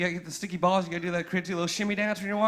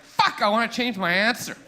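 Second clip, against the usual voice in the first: loud, higher-pitched blurt of regret; acted outburst; 5 semitones higher, 9 dB louder than usual. To girlfriend who said fifty-six, loud mock-panicked outburst.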